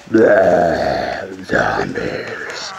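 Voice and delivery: low, deep groaning zombie. Pretend. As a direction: moan it like the undead. Mimicking zombies during game.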